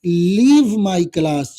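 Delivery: very slow, word by word for notes; slow dictating teacher; slow dictation. Shown as a demonstration. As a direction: spell it out, then say it slowly. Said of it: "तो कैसे बोलिएगा".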